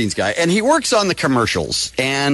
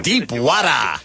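mock Cajun-like accent; 4 semitones lower, slower than usual. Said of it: "Are you trying to be Cajun?".

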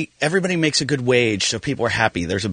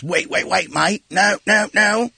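higher-pitched retold warning, climbing on each no; mock urgent warning; 8 semitones higher, slower than usual. Comedian imitates guy stopping him at crawl space, mock urgent warning. Australian guy warns of deadly spider.

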